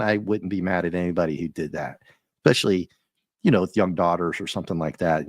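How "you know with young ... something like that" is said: normal talking voice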